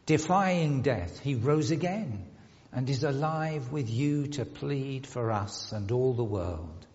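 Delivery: ordinary delivery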